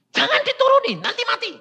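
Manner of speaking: high, pleading grandparent voice; a fussing grandparent's cry; alarmed grandparent. Retold or imitated. Grandparent frets at sick child, high alarmed plea.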